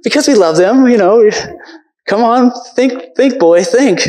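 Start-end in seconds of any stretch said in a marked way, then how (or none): 2.1-4.1 high, slow mock coaxing of a boy; a father prodding his boy to think, half-mocking; stern dad urging. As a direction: push a slow son to think, lightly hammed. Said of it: "what my dad would always tell me".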